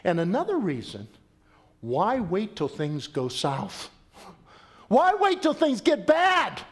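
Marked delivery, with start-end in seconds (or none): 4.9-6.6 high, loud, slowed question; emphatic challenge. Real, not acted. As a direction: press the question on the congregation. Preacher's pointed question.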